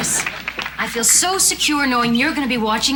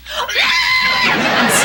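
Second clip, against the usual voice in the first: startled shriek; 5 dB louder than usual. Real, not acted. Shocked at the magazine.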